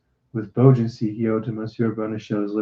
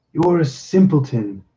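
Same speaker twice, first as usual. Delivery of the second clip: usual speaking voice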